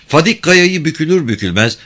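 ordinary delivery

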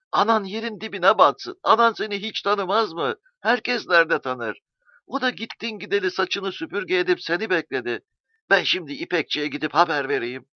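high, loud cries of a mother's joy; overjoyed mother. A narrator's reading. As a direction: let the joy burst out, voice up high. Mother overjoyed at son's return.

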